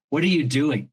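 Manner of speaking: lightly mock the scolding parent's question; shaming tone. Parent walks in on kid skipping homework, mildly shaming. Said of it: "the tone is in that question".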